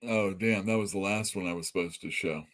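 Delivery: ordinary delivery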